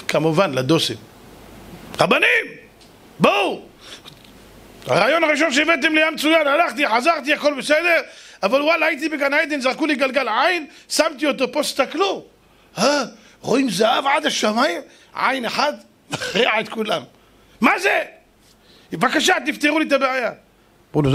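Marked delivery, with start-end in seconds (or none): 2.0-3.6 high-pitched calling out; urgent summons. Acted. King summons the rabbis for help, calling out. 4.9-20.3 high, insistent, bewildered pleading; agitated complaint. Acted. King begs the rabbis to explain the eye, agitated.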